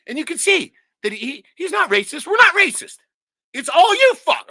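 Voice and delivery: furious rising yell; real angry shout. Real, not acted. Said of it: "I'm kinda pissed off right now".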